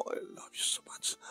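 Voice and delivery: voiceless, soft whisper of love; intimate whisper. Acted. Daniel whispering his love to Gina. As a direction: breathe the words into her ear.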